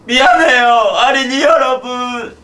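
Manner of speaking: high, loud exaggerated sorry; mock apology. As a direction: apologize like a cartoon host.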